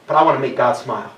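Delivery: normal talking voice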